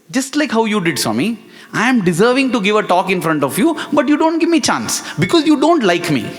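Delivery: mock angry tirade at the guru; angry outburst at Swami. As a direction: rant at him like a wronged kid. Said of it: "I got fully angry on Swami".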